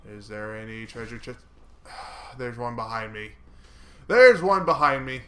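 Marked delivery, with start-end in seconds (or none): 4.1-5.2 much louder, higher warning shout. Real, not acted. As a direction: yell it out as a warning. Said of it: "repeated louder".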